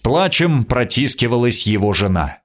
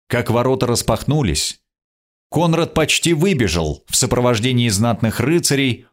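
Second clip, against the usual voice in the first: ordinary delivery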